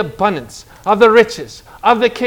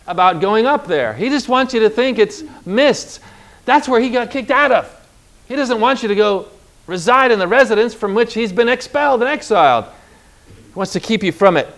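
usual speaking voice

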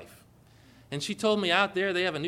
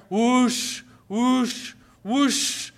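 loud, higher whoosh, imitating a roaring machine; loud whooshing sound; 6 semitones higher, 5 dB louder, much slower than usual. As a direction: blast the whoosh out. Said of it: "And then louder".

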